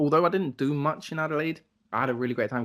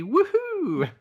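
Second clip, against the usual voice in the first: mock cheer; 4 dB louder than usual. Cheering about drinking.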